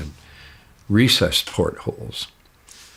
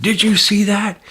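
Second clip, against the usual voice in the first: much higher, louder startled question; shocked; 13 semitones higher, 4 dB louder than usual. Retold or imitated. Narrator startled by an alien head at the van window, shocked outburst. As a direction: blurt it out in sudden shock. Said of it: "it shocked me".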